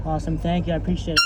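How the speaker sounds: ordinary talk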